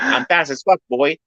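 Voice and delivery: high, lightly squeaky quote; tossing off the line in a light helium voice; mock Danny quote. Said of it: "he's always like".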